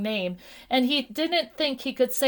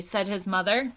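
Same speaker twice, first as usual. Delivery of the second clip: usual speaking voice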